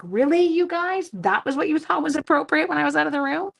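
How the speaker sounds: exasperated teacher scolding. Retold or imitated. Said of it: "I'm like".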